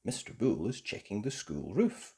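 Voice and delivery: ordinary delivery, nothing marked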